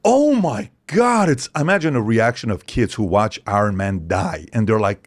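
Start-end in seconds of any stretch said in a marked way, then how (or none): 0.0-1.3 high, slow, drawn-out amazement; stunned gasp. Retold or imitated. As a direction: let the disbelief stretch out. Kids react to a celebrity call.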